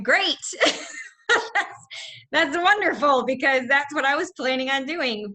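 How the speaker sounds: high, loud mock delight through a laugh; sarcastic. Real, not acted. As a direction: gush "great" and "wonderful" as a sour joke. News says history will be cut.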